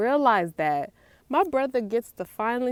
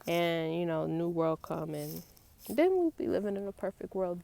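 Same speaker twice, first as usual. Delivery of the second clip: normal talking voice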